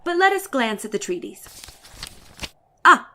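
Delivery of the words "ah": usual speaking voice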